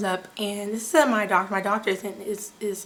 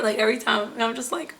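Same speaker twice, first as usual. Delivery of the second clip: ordinary talk, nothing marked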